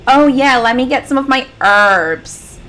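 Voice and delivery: put-on a clumsy American accent asking for herbs; forced American accent. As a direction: do a bad American accent, slowly. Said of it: "bad at forcing American accents".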